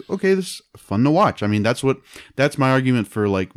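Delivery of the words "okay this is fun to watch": higher, lightly pleased; a touch of easy enjoyment; mild approval. Retold or imitated. Host imagining a better movie, lightly pleased higher voice. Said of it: "I would have been like".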